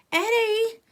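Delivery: high loud scream of a name. A narrator's reading. A girl yelling at Eddie to stop the shoving, screaming. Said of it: "screamed one of the girls".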